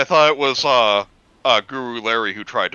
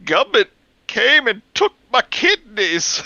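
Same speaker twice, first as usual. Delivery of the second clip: high, slow put-on creepy voice; a high strained voice for the monster; line for him; 12 semitones higher, 6 dB louder than usual. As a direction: squeeze it up high and drag it out. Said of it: "I am going to say a line for him".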